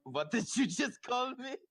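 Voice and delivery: mock offended. Mock offended character reply.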